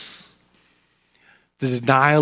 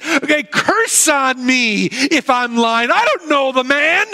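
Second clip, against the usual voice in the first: higher, quicker voice of a nervous Peter pleading innocence; Peter, anxious and defensive; 10 semitones higher than usual. Acted. Peter to the courtyard crowd, denying he knows Jesus, nervous and higher. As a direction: play Peter insisting he's just a bystander, pitched up.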